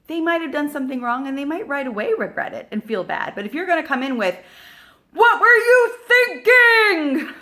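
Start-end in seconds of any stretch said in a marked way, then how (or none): 5.1-7.3 sudden shrill yell, much higher and louder, half laughing; attacking parent. Retold or imitated. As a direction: snap at the kid in mock outrage. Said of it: "you're coming in attacking them".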